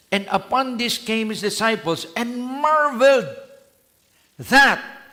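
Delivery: loud, high, slow verse read; emphatic scripture reading. Acted. Preacher rereads the verse to the church, loud and high. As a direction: proclaim the verse with force.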